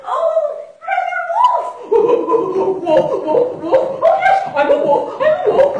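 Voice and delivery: high, loud, cackling wolf babbling through laughter; manic laughing wolf. Acted. Confused wolf to Jumping Mouse, high loud manic laughter. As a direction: laugh wildly like a wolf who forgot himself. Wolf has lost his memory.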